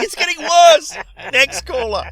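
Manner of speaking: high, very loud mock-panicked yell; hammed-up cry of dismay; exasperated shout. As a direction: shout it like a host losing control. Host acts out exasperated panic.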